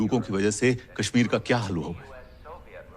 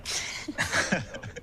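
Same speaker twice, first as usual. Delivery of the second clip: soft short laugh; a quiet chuckle at one's own joke; 7 semitones higher, 9 dB quieter than usual. Acted. Speaker joking about her blood pressure, soft laugh.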